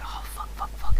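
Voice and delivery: whispered curses under the breath; frantic whispered swearing; panicked whisper. Retold or imitated. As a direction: hiss it in a panic, barely voiced. Panicked reaction at the door.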